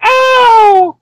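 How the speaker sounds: high, drawn-out mock "ow"; imitated scream. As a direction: mimic her underwhelming yelp of pain. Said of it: "she was just like".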